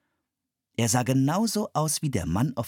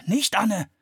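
high, louder warning cry; alarmed shout; 12 semitones higher, 5 dB louder than usual. A narrator's reading. Brother calls out to stop his sister, alarmed. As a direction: stop her before she opens it. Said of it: "rief Philipp".

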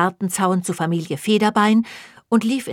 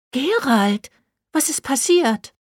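higher-pitched, slowing concern; concerned question; 7 semitones higher, slower than usual. Acted. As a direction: ask anxiously what happened. Narrator worried about Gerald.

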